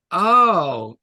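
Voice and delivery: a loud, startled "oh"; retold surprised oh. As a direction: react as if it suddenly clicks. Realizing the teacher's complaint.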